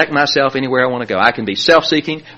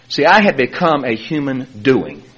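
ordinary delivery

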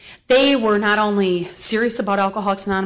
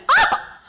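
high laughing yelp of surprise; startled laughing yelp. Retold or imitated. Hearing Milton introduce himself, a high laughing yelp. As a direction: squeal it out with a laugh.

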